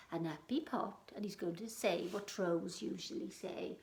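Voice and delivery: ordinary delivery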